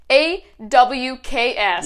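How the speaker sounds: loud, very slow letter-by-letter spelling. Real, not acted. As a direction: spell each letter out slowly and emphatically. Spells out the word she typed.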